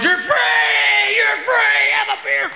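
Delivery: high, loud cry of liberation; jubilant shout. Pretend. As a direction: yell it like a jailbreak hero. Freeing the rehab inmates.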